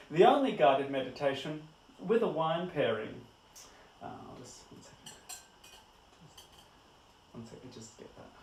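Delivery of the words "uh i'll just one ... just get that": very quiet, low aside to self; distracted aside. Real, not acted. Host breaking off to get something off their fingers, distracted aside. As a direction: drop the show voice and talk softly, half away.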